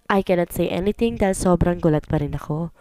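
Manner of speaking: usual speaking voice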